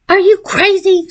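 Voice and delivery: high, panting outburst; breathless and angry at being shut out; panting furious. A narrator's reading. Stephanie panting at friend who slammed the gate, furious. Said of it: "she panted".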